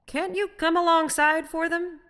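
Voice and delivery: high, loud coaxing question; calling out. A narrator's reading. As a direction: call over the rail to coax him. Calling to stranger over the rail.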